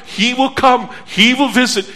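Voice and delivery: usual speaking voice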